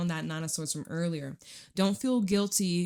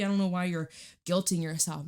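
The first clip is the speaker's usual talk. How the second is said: ordinary talk, nothing marked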